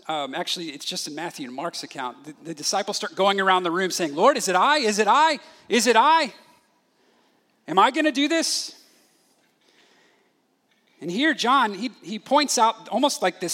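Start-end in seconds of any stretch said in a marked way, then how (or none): 4.2-8.8 anxious questioning. Acted. Disciples asking around the room.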